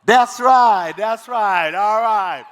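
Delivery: loud, shouted hype. Real, not acted. Emcee hypes the crowd back to the main stage. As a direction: shout it out to pump the room. Hyping crowd after music.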